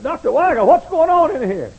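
high, loud startled question; surprised. Retold or imitated. Preacher finding the old man, high loud surprise.

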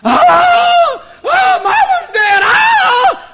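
high, loud wailing shout; shrieking grief, slowed and loud; hysterical mourner. Retold or imitated. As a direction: wail over a dead mother at a funeral. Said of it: "people just went hysterical".

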